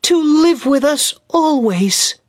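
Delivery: put-on a small boy begging his mother to come; lightly voiced boy, bursting with joy; eager child cry. A narrator's reading. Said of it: "he cried".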